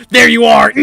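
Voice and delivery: loud, gleeful call of the hunter; triumphant shout. Acted. Game master as Jane spotting her prey, shouting. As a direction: yell it out as you spot your prey.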